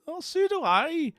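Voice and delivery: high, slow excited gasp. Retold or imitated. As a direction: squeal it out with delight. Excited Dunfermline woman quoted.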